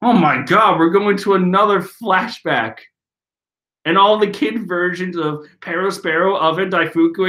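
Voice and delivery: ordinary delivery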